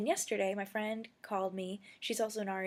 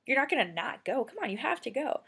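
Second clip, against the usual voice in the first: louder, higher retold pep talk; insistent urging; 5 semitones higher, 7 dB louder than usual. Speaker urging friend to see the dog, insistent. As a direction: push a reluctant friend, emphatically.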